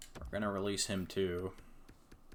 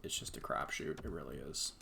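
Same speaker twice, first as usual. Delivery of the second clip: low, soft resigned whisper; soft, low resigned murmur; defeated mutter; 7 semitones lower, 8 dB quieter than usual. Real, not acted. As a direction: murmur it to yourself.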